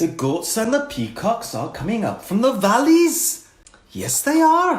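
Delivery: a light attempt at a Welsh accent; sing-song Welsh accent, rising high. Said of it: "his attempt at a Welsh accent".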